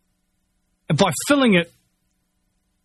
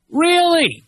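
sarcastic really; 10 semitones higher than usual. Real, not acted. Sarcastic reaction to company claim.

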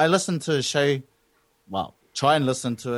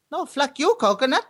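put-on very high Chinese-accented retort; hammed-up high Chinese accent for the punchline; mock Chinese accent; 13 semitones higher than usual. Chinese customer's punchline to dairy owner, mock Chinese accent. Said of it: "the Chinese goes".

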